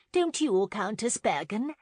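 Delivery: high, loud appeal for agreement; effusive matron's question; eager interjection. A narrator's reading. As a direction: press eagerly for a yes. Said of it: "Missus Clark suddenly interjected".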